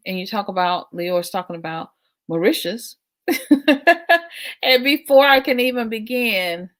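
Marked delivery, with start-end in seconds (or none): 3.3-4.6 a bright laugh, pitched up; high laugh. Real, not acted. Laughing at Leo's Mauritius comment, high and bright.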